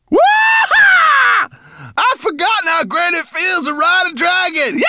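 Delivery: very high, loud whooping; exhilarated cries. A narrator's reading. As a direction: shout it out, thrilled by the ride. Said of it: "cried Berber Chan".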